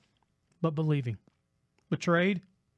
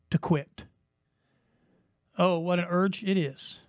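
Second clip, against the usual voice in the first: ordinary delivery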